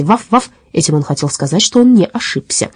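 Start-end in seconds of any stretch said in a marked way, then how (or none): 0.0-0.5 higher spoken woof-woof; two light mock barks; dog bark. A narrator's reading. Dog insisting he made no mistake, mock bark. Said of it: "залаял Васти".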